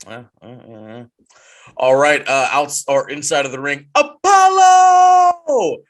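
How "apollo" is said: drawn-out, soaring announcer call of the name, much higher and louder; hype call. Acted. Host announcing Apollo's return, drawn-out ring-announcer call.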